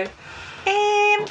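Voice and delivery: high, held Newcastle "um"; Newcastle hesitation sound. Shown as a demonstration. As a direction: demonstrate the Newcastle "um", drawn out. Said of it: "if you're in Newcastle".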